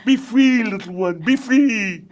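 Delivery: high, loud, theatrical release; high, loud cartoon voice bidding farewell; dramatic farewell. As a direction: send it off grandly, like a hero. Voicing a character.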